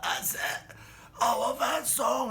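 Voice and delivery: high, very loud, slow cheer; delighted shout. Retold or imitated. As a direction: roar approval at the band. Bully cheers loudly.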